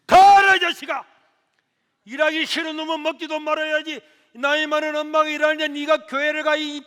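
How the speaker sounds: high, loud furious yell at her son; mother yelling at her son for skipping work, shouted; mother's angry scolding. Retold or imitated. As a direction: roar like an enraged old mother. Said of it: "하면서 막 욕을 하는 거야".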